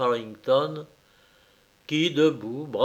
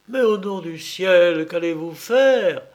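higher, louder cry of anguish; 5 semitones higher, 7 dB louder than usual. Acted. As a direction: cry out in rising panic. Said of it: "s'écria Poltavo au comble de l'angoisse".

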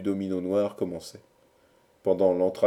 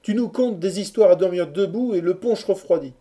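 high, loud impatient reproach; scolding chorus; 10 semitones higher, 6 dB louder than usual. A narrator's reading. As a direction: scold the storyteller on behalf of everyone. Chorus scolds the rambling lover. (reproche impatient d'une voix haute)

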